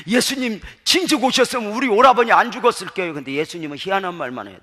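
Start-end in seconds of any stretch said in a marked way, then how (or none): 0.1-3.1 imitating a grieving sister, high and loud; acted tearful reproach. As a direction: plead loudly as a bereaved sister. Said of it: "울면서 이렇게". (울며 원망하는 흉내)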